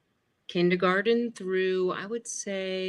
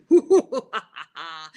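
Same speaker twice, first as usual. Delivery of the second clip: hammed-up haunted house cackle; loud hooting "ooh" into a villain laugh; spooky ghost laugh; 2 semitones lower, 9 dB louder than usual. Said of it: "into the haunted house and you'd hear".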